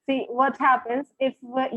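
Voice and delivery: ordinary talk, nothing marked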